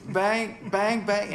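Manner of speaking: high, loud bang sounds; mock gunshots. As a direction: pretend to shoot a gun. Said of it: "And I go".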